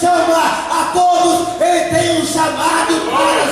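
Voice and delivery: loud, high, chanting list; full-force preaching. Real, not acted. Preacher telling everyone Jesus calls them, shouting. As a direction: hammer each phrase out in rising fervour.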